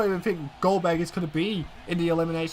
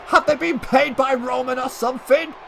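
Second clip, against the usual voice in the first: furious, much higher and louder ringside yelling; outraged shouting; 7 semitones higher, 11 dB louder than usual. Acted. Commentator yelling for security after Reigns' attack, furious shout. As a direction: scream at security like the attack just happened in front of you.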